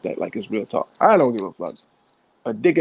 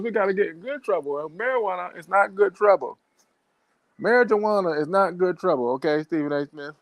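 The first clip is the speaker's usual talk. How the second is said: mock preachy lecture; 6 semitones higher than usual. Mock lecture aimed at Stephen A.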